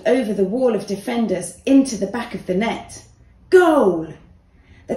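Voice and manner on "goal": loud, high cry of Goal; goal shout. A narrator's reading. Narrator cheers the winning free kick, loud shout.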